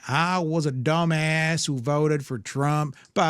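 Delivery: slow, drawn-out imitation of a regretful Trump voter's American accent; mock American voter accent. Host mocks the regretful voter, putting on his American accent. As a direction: parody the American accent heard in the clip, slowed right down. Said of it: "that's the accent you expect, eh?".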